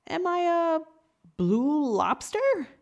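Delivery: high and loud, dragging out the last words slowly; stunned, barely believing it; shocked disbelief. Acted. Lobster realizing he is a lobster, slow stunned disbelief.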